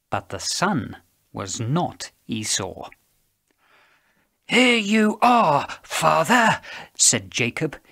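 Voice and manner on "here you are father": put-on gruff voice, loud and slow; gruff disguise. A narrator's reading. Jacob posing as his brother to blind Isaac, strained gruff voice. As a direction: force out a gruff voice, pitched up with effort. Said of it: "in as gruff a voice as he could muster".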